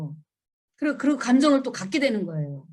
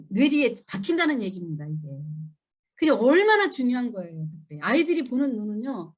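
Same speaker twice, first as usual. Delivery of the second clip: ordinary talk, nothing marked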